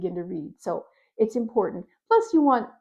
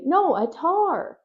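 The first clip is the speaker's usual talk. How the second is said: put-on high, slow, louder child "No, a tar"; child insisting; 9 semitones higher, 4 dB louder, slower than usual. Child insisting "tar" to a parent, high and slow. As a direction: insist like a little kid. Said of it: "they'll say".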